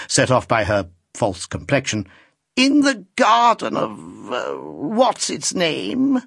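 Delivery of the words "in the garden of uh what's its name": put-on an old lady's coy, teasing reproach; coquettish old lady. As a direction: flutter it out like an aging coquette. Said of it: "returned the lady".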